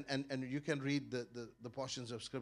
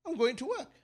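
cheeky retort; 13 semitones higher, 10 dB louder than usual. Retold or imitated. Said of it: "I said".

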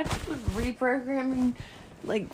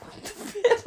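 laughing disbelief; 10 semitones higher, 3 dB louder, slower than usual. Retold or imitated. Imitating her exasperated outburst.

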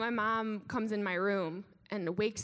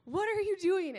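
upset; 8 semitones higher, slower than usual. Retold or imitated. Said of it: "rather upset".

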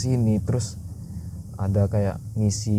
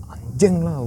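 retold anger; 7 semitones higher, 3 dB louder, slower than usual. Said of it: "tiba-tiba marah gitu".

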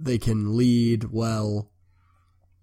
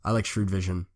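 normal talking voice

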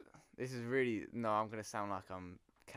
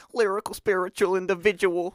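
mock pretentious rapper; 9 semitones higher, 15 dB louder than usual. Mocking a pretentious rapper type.

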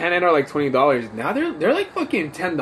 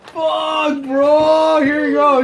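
laughing shout; 12 semitones higher, 5 dB louder, much slower than usual. Real, not acted. Laughing outburst at friend.

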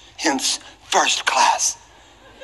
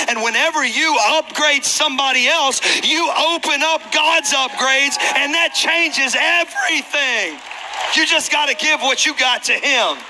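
preaching; 9 semitones higher than usual. Real, not acted. Preacher urging the congregation to serve, rising and emphatic. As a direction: drive the lesson home to the crowd.

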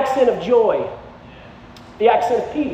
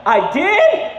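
retold astonishment, pitched high; surprised; 7 semitones higher, 3 dB louder than usual. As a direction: imitate a friend caught completely off guard. Friend learns of his accent.

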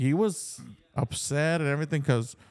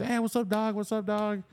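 imitating a friendly greeting; 7 semitones higher than usual. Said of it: "And it's".

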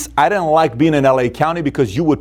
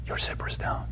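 hushed, discreet whispered tip-off; 15 dB quieter than usual. Retold or imitated. Girl privately warning the speaker in his ear, whispering. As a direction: whisper it so only he hears. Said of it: "she whispers in my ear".

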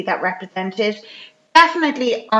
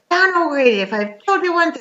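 higher-pitched retold telling-off; mock nagging wife; 7 semitones higher than usual. Wife to Dan about money, mock nagging. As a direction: lightly act an exasperated wife.

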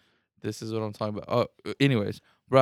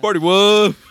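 laughing shout; 9 semitones higher, 10 dB louder, slower than usual. Real, not acted. Loud laughing repeat.

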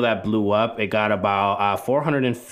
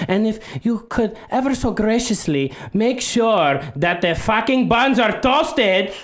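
high, loud, aggressive accented cartoon voice; Pepe Le Fuck You; 13 semitones higher, 4 dB louder than usual. Rude character orders at drive-through, high forceful mock outrage. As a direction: bellow demands in a high put-on accent. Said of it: "Pepe to go into whatever fast food restaurant".

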